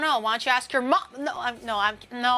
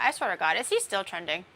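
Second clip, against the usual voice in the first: usual speaking voice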